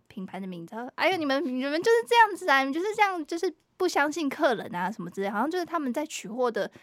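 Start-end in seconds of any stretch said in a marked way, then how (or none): 0.9-5.3 a hint of a loud scolding old auntie; loud complaining auntie. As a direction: complain to the shop staff, voice raised a little. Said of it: "很大声说话".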